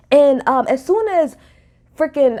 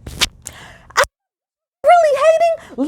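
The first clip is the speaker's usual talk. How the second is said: mock her innocent outrage; mock Sutton; much slower than usual. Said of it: "Sutton is like this".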